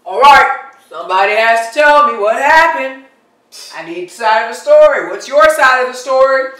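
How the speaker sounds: loud, commanding authority figure; stern questioning. Acted. Officer questions two groups after a fight, stern questioning. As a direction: take charge loudly and demand answers.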